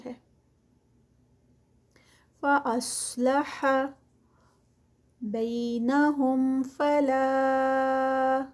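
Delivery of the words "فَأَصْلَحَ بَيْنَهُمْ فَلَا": slow, drawn-out tajweed recitation; Quranic recitation. Shown as a demonstration. Teacher reciting the verse for students, slow and melodic. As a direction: chant each word slowly, stretching the vowels.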